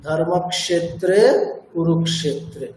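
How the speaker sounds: slow, chanted Sanskrit verse; verse recitation. Shown as a demonstration. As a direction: intone the verse slowly. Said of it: "example হচ্ছে গীতার একেবারে প্রথম শ্লোক".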